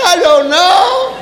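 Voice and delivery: slow, laughing dopey I don't know; clueless child. Retold or imitated. Child asked why, slow dopey shrug. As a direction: play the kid who has no clue. Child's blank reply again.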